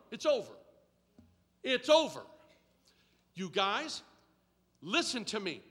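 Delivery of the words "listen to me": ordinary talk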